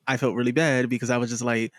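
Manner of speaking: ordinary talk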